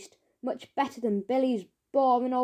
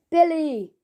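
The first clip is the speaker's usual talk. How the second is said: higher, louder startled call; startled cry; 5 semitones higher, 5 dB louder than usual. Acted. Mister Gum caught eating, crying out in surprise. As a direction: caught out, blurt the name. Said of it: "cried Mister Gum".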